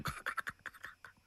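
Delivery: soft, voiceless rhythmic clicks; imitated hoofbeats. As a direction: click like hooves drawing nearer. Imitates hooves of an approaching horse.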